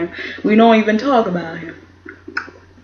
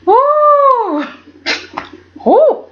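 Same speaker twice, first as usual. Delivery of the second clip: excited whoop. Real, not acted. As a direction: let out a thrilled cheer. Cheering after calling Katie out.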